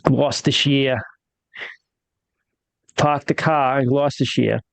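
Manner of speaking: put-on broad Boston accent, no r's. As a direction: pahk the cah Boston style. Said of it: "Say it with a Boston accent now".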